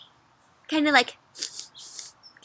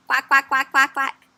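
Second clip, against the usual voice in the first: quick run of duck quacks; playful imitated quacks; duck quacking; 2 semitones higher, 6 dB louder than usual.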